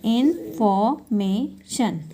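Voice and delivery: "Information" very slowly in four parts; syllable by syllable. Shown as a demonstration. Teacher dividing a word into four, slow and separated. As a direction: break "information" into इन, फॉर, मे, शन with gaps. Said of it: "parts में इसको divide किया".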